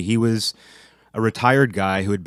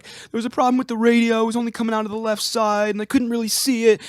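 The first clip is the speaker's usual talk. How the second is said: put-on very high complaining old man; squeaky grumbling complaint; whiny high voice. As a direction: whine it up high like a put-upon employee. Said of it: "one of those guys who just complained".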